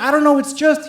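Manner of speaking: cornered evasion. Acted. Cornered, evasive in acted argument.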